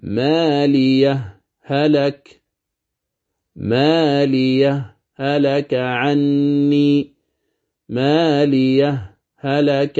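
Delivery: ماليه recited very slowly with a brief silent stop before هلك; sakt. Shown as a demonstration. Teacher slowly chanting the sakt reading to students. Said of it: "هذا هو السكت".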